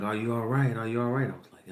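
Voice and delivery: usual speaking voice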